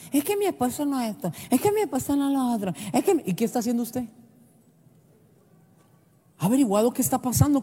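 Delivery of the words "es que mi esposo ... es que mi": complaining wife. Retold or imitated. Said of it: "Muchas mujeres se quejan".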